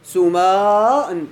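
سماء slowly, long aa held before a final n; drawn-out underlying form. Shown as a demonstration. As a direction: draw out سماء to expose both silent letters. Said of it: "ما هما الساكنان". (مدّ ألف سماء ببطء ثم إظهار نون التنوين)

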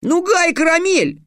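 put-on a boy shouting a name in triumph; boy crying out. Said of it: "выкрикнул".

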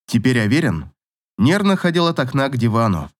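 plain reading voice

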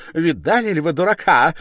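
high, agitated mocking cry; loud derision. A narrator's reading. Stepmother pointing out the accuser to all, loud scorn. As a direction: call everyone to witness, loudly.

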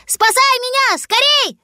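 very high-pitched cry; crying for help. A narrator's reading. Fox cub on the island begging rescue, high cry. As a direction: call frantically to be rescued. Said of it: "закричал он".

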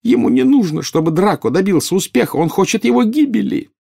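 loud, high hysterical wail; choked with tears. A narrator's reading. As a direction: cry out in terror for your son. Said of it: "захлёбывалась слезами Нарцисса". (истошно, сквозь слёзы)

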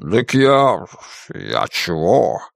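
halting, drawn-out mild guilt; guilty mumbling. A narrator's reading. Драко excuses himself to the witch, guiltily. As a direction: stall slowly, a touch sheepish. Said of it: "виновато развел крыльями Драко".

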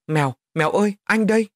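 high, loud, urgent call; shouting. A narrator's reading. Boy calling to the girl in danger, high urgent shout. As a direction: shout out to reach her. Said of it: "Tôi la lên".

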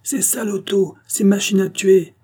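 high, accusing outcry; angry crowd jeer. A narrator's reading. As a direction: hurl it as an insult from the crowd. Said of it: "qui invectivaient le watman". (invective criée, accusatrice)

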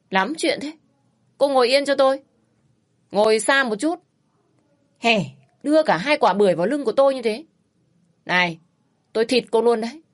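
annoyed man threatening her, half joking; rude scolding. Acted. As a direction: bark orders at the passenger. Rude rider scolding his passenger.

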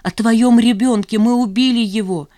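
high, loud cry of guilt; anguished accusation. A narrator's reading. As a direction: blurt it out in anguish. Anguished answer, self-accusation. (с болью, громко и высоко)

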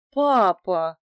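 loud, stunned outcry; shocked gasp of a word. A narrator's reading. Daughter stunned by her father's accusation, shocked. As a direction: blurt it out in shock. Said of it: "вымолвила поражённая дочь".

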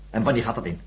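normal talking voice

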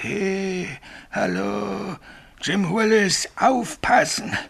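high, eerie, drawn-out call; ghostly call. Retold or imitated. Signalman mimics the ghost for Bill, eerie. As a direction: echo the phantom's voice.

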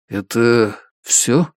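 ordinary delivery, nothing marked

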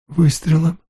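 тихий вопрос с подъёмом, в неверии; переспроси, ещё не понимая; ошеломлённый переспрос. Acted. Отец не верит услышанному. (ошеломлённое неверие)

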